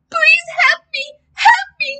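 very high, loud plea; crying out for help. A narrator's reading. Boy begs his dad for help, high wailing cry. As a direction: wail it out, high and desperate. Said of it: "I cried".

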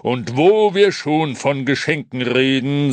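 loud, ringing Santa announcement; booming voice. A narrator's reading. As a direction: boom it out to the crowd. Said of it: "mit seiner schallenden Stimme zu der Menge".

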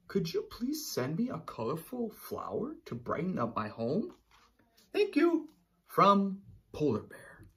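storyteller voicing the polar bear writing to a friend; letter voice of polar bear. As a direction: read the letter as the little bear pleading. Reads polar bear's letter.

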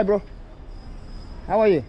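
ordinary talk

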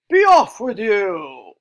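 loud, slow troll dismissal. Acted. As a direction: send the goat away in a big, drawn-out troll shout. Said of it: "what'd he say?".

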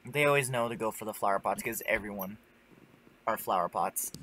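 ordinary talk

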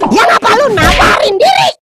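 high, wailing shout; loud, high-pitched scolding; exasperated outburst. Acted. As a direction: yell in disbelief at a fool. Outburst at a foolish volunteer.

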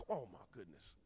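usual speaking voice

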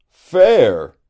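sharp loud cry of disbelief; shocked outburst; cried out. A narrator's reading. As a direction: throw the word back at her, loud and incredulous. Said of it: "he cried".

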